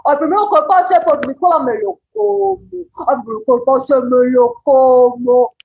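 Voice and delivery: loud growling monster threat; a hairy monster hungry for passers-by. Shown as a demonstration. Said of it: "puedo hablar con una voz grave".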